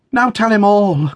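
high, loud plea; urgent cry. A narrator's reading. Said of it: "cried Dot".

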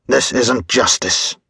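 ordinary delivery

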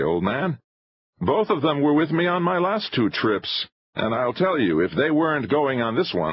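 high-pitched, emphatic praise of his men; energetic filmmaker, vouching firmly; impatient showman. A narrator's reading.

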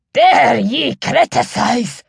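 a hint of a furious laird shouting. A narrator's reading. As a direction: fling it back as an outraged command. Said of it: "shouted the old laird".

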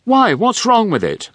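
put-on very high posh young woman; posh daughter. A narrator's reading. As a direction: ask it high and prim. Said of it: "asked the daughter".

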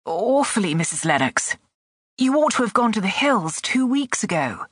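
a touch of tremble, urgent reproach; trembling voice. A narrator's reading. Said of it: "answered in a trembling voice".

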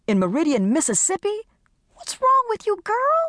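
high, disbelieving outburst; can't believe what she's hearing; incredulous. Acted. Carmen reacting in disbelief to her friend's plan, high.

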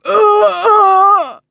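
loud high cry of agony choking off; a man overcome by pain crying out; agonized gurgling cry. A narrator's reading. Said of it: "Ivor's voice gurgled into a growl as the pain".

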